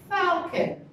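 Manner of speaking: falcon with FAL punched; teacher modelling falcon, FAL stressed; stress on first syllable. Shown as a demonstration. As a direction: say FAL-con, first part strong. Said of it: "the stress would be on the first part".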